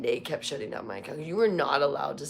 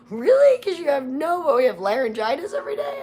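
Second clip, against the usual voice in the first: mock disbelief; 12 semitones higher, 9 dB louder than usual. Mocking people's disbelief.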